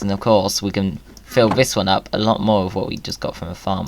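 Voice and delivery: ordinary talk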